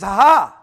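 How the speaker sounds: loud scornful "ha ha"; a jeering burst of laughter; mocking laugh. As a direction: laugh him off loudly.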